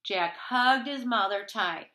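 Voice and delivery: usual speaking voice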